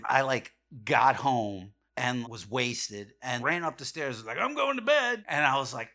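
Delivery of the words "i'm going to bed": loud, high announcement; re-enacted tipsy shout; drunken call-out. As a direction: holler it hastily on the way upstairs. Acts out sneaking past parents drunk.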